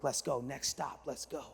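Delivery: will it along very quietly; under the breath. Retold or imitated. Nervous husband willing the train onward, under his breath.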